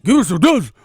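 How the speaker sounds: loud, high clown cry; Pennywise. Acted. Clown demanding drugs, loud high manic voice. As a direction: yell it as a manic killer clown. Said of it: "when I be doing the Pennywise".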